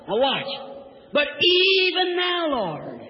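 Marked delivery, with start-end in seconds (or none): 1.1-3.0 soaring pulpit refrain; preacher's faith cry. Acted. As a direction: stretch it high, then fall away. Preacher cries Martha's faith refrain.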